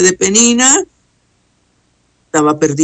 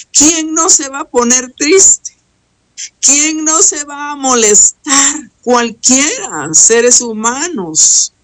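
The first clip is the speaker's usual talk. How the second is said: higher, faster, fervent sermon push; rising preaching; 5 semitones higher than usual. Acted. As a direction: preach it up to the peak, pressing on. Preacher rises to full force.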